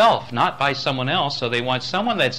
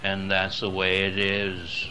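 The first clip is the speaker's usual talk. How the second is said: monotone; 3 semitones lower than usual. Shown as a demonstration. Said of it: "others are us talking like this".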